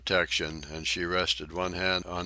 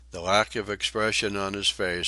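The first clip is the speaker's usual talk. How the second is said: plain reading voice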